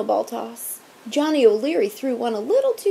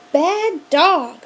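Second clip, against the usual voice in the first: loud, higher bark of a scold; scolding shout; 6 semitones higher, 7 dB louder than usual. A narrator's reading. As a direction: scold the dog loudly. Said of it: "shouted Principal Renfield".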